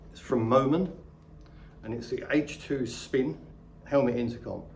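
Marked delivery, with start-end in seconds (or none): none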